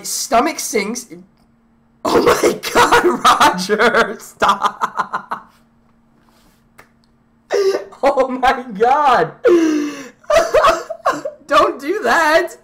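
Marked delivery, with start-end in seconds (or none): 2.0-5.5 laughing. Real, not acted. Laughing at Roger to stop filming. 8.0-12.6 laughing. Real, not acted. Still laughing at Roger.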